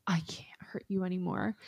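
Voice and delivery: hushed, whispered brooding line; Edward. Retold or imitated. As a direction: whisper it softly, imitating a tortured lover. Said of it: "Edward is like".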